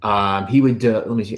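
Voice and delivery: ordinary talk, nothing marked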